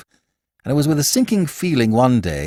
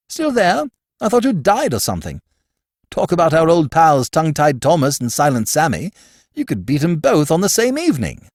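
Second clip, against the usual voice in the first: put-on a touch higher, breezy young toff teasing; lightly voiced Archie; 7 semitones higher, 3 dB louder than usual. A narrator's reading. Archie teasing his gloomy brother-in-law at lunch, airy and light. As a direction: rib your friend with airy cheer.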